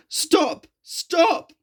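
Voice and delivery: very high pained cry; fearful. A narrator's reading. Engine in pain begs his driver, very high frightened cry. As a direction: beg in terror, high. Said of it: "said James fearfully".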